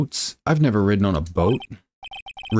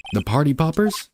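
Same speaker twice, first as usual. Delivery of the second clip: ordinary talk, nothing marked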